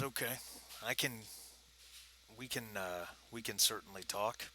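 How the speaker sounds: soft, lightly gravelly British dealer; low gravelly voice. Acted. Dealer agreeing to talk business, soft gravelly voice. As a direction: keep it hushed, a hint of gravel. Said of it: "he's got that low gravelly voice".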